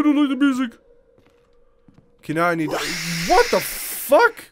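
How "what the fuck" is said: high shocked squeal; shocked high yelp. Real, not acted. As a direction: squeal in disbelief. Sudden scare.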